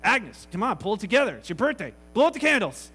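loud, awkward prodding, rising to a shout; uncomfortable urging. Retold or imitated. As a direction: urge her along loudly. Uncomfortable man urging weeping Agnes.

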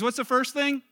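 usual speaking voice

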